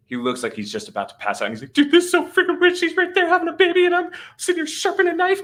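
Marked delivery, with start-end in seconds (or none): 1.7-5.4 very high, loud mimicked panic; freaking out. Buddy sharpening a knife during the birth, mock freak-out. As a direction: rush it in a squealing, panicked imitation. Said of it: "kind of freaking out".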